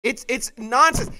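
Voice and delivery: usual speaking voice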